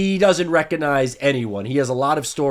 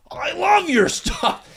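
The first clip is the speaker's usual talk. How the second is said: much higher and slow, a clueless dad gushing; much slower than usual. Retold or imitated. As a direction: gush like a dad who has no idea who he's talking to. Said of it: "And my dad was like".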